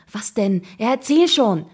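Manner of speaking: high, loud, pressing plea; impatient begging. A narrator's reading. Boy begging his friend for the news, impatient. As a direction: urge him on, bursting to hear it. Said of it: "bettelte ich ungeduldig".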